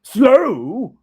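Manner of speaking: high, loud incredulous echo; indignant ghost. Acted. Ghost retorting to Scrooge, high and loud. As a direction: fling the word back.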